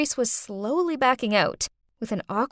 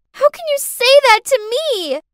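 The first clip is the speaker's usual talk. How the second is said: put-on much higher, loud childish shout; 14 semitones higher, 8 dB louder than usual. Girl shouting at the babysitter, much higher and louder. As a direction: yell it like a wounded little girl. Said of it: "Clarice shouted".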